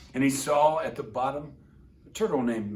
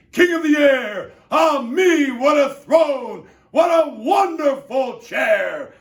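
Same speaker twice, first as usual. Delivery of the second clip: very high, loud, grand boasting; gloating turtle king; 10 semitones higher, 11 dB louder than usual. Acted. Yertle shouting his boasts from forty miles up. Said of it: "shouted Yertle".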